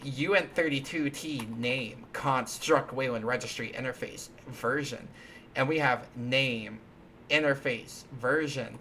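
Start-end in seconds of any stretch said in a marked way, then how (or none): none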